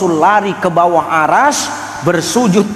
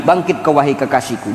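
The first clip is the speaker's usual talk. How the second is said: ordinary talk, nothing marked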